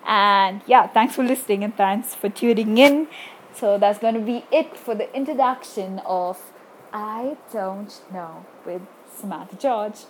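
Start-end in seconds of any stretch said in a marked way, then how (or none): 3.5-10.0 sexy voice. Shown as a demonstration. Host attempts a sexy voice for the show title, failing. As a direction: try to sound seductive and not quite manage it. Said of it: "I tried to go for a sexy voice".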